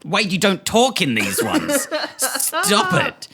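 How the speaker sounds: high-pitched, squealed telling-off; mock scolding. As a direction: scold a co-host in playful exasperation. Acts scolding Wade for talking.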